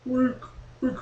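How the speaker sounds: soft echo of WEEK; self-made echo. Pretend. Host insisting there is echo, echoing WEEK softly. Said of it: "It's an echo usually".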